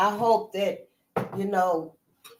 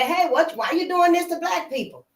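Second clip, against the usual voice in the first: higher-pitched imagined objection; acted protest; 8 semitones higher than usual. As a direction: speak up as an imagined challenger. Voices the objection nobody has made.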